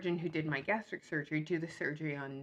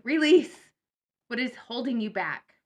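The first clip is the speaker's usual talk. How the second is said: emphatic; 6 semitones higher, 7 dB louder than usual. Real, not acted. Reading title emphatically.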